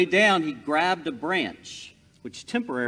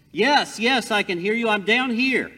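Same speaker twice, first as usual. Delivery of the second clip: higher and louder, relieved and eager; eager shout back; 8 semitones higher, 8 dB louder than usual. Acted. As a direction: call up to the voice. Jack answers the voice above.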